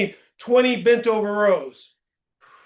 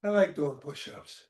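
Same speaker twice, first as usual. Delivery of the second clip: quiet sincere aside; 4 semitones lower, 7 dB quieter than usual. Real, not acted. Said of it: "but I actually like".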